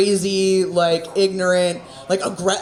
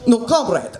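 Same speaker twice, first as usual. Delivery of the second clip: brief mock Russian accent; 3 semitones higher than usual. Comic obliging an audience request, mock Russian accent. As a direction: put on a Soviet Russian accent. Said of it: "Do it in the accent".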